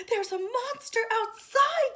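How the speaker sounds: high, loud scared report; frightened. Acted. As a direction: tell it in fright. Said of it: "said Baby".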